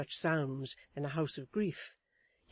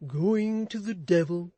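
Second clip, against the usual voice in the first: thoughtful husband; 4 semitones higher, 8 dB louder than usual. A narrator's reading. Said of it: "said Mister Chick thoughtfully".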